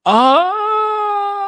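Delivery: a high, loud cry of surprise; sudden loud gasp-like "Oh"; surprised cry. Real, not acted. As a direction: let out a startled high "Oh". Reacting to news on screen.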